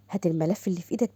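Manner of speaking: hushed whisper; urgent secret whisper. Acted. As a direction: whisper it so no one hears. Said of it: "هو ده اللي محدش هيعرف".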